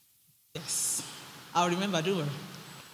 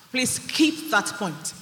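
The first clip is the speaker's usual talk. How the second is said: ordinary delivery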